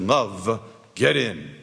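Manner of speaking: low, slow storybook voice of authority; deep, stentorian voice. Acted. Mysterious rescuer summons despairing Love, deep stentorian voice. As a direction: intone it deep and measured. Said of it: "a deep, stentorian voice".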